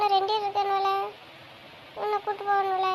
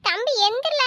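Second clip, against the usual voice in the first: high, loud wake-up call; urgent calling out; 6 semitones higher, 5 dB louder than usual. Acted. Sister waking sleeping brother, loud urgent call. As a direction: raise the voice to get him up.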